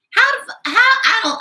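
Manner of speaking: high and loud, cut-off disbelief; sputtering, stunned exclamation; shocked outburst. Real, not acted. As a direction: blurt it out as if you can't believe it.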